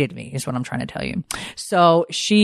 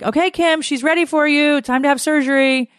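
much higher, sing-song mock nurse voice; chirpy nurse imitation; 12 semitones higher than usual. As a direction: call it out high, imitating a nurse. Retold nurses calling her to surgery.

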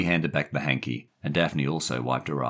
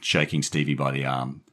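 plain reading voice

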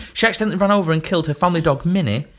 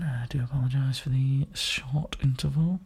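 hushed whisper reading a text; 7 dB quieter than usual. Retold or imitated. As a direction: whisper it slowly.

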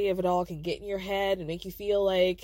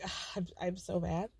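low, soft sighed-out words; imitated exasperated sigh; 4 semitones lower, 9 dB quieter than usual. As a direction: sigh it out, imitating someone fed up. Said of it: "she just sighs and is like".

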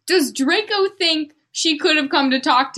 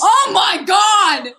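shocked shout; 6 semitones higher, 6 dB louder, much slower than usual. Real, not acted. Shock at Draco's death.

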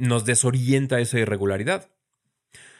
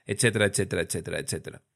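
fading etcetera; 4 semitones lower, 5 dB quieter, much faster than usual. Real, not acted. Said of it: "trailing off list".

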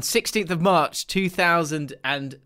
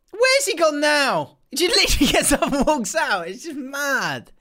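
bewildered wife; 10 semitones higher, 4 dB louder than usual. Pretend.

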